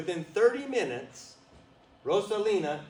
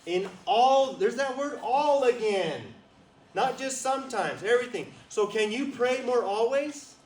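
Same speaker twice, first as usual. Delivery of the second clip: higher, louder, fervent preaching; full-force preaching; 8 semitones higher, 8 dB louder than usual. Acted. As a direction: preach it with rising fire.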